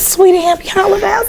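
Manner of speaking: gracious first lady greeting a student, replayed on request; Michelle Obama's voice. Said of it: "after Michelle, she said".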